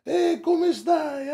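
put-on high squeaky baby talk to a dog. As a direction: coo at the dog high and slow.

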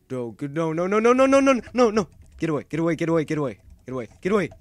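high, loud string of frantic cries; panicked shouting. Real, not acted. Player fending off a mob at close range, panicked shouting. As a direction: yell it rapid-fire, rising in panic.

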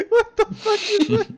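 high squeal through laughter; laughing. Real, not acted. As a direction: shriek it while cracking up. Laughing hard.

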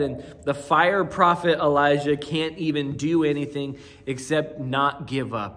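usual speaking voice

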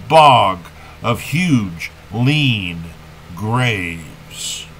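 slow, emphatic poetry reading with measured pauses; poem recitation. Acted. Poet laureate reads a Kenneth Patchen poem to listeners, solemn recitation. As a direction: recite it deliberately, letting the line endings stretch.

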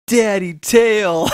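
put-on high, loud, slow little girl's voice; toddler voice. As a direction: draw it out like a tiny toddler. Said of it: "and she goes".

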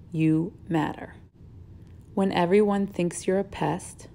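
slow, tender poem reading; reading aloud. Acted. Teacher reading a picture book to students, slow and gentle. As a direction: read each line gently with pauses.

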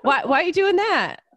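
high, loud, laughing questions; hammed-up baffled pestering; mock bewilderment. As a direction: squeal it in comic confusion. Acts out bewildered questioning of colleagues.

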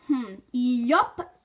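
laconic farm-horse yup; a one-word country answer, lightly voiced; Big Macintosh. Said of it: "That was Big Macintosh".

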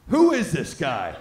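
loud, slow, mock disbelief; incredulous outburst. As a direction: belt out the question in amazement. Comic's retold disbelief at Stu.

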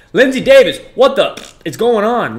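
high, very loud and excited; amazed shout-out. Real, not acted. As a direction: holler the donor's name in amazement. Amazed shout at repeated gift.

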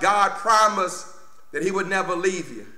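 ordinary talk

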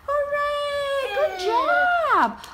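high, bright cheering praise; excited cheer. Real, not acted. Praising toddler's success.

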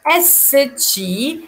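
teacher models the letters slowly; slow model sounds. Shown as a demonstration. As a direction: draw out اس تشي for learners. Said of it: "هنا اس تشي".

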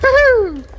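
very high, loud woohoo; whooping. Real, not acted. Player cheers mid-game, high loud whoop.

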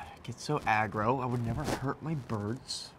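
usual speaking voice

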